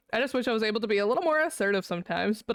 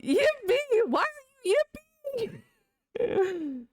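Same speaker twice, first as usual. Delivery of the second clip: high mocking voice; much slower than usual. High put-on voice.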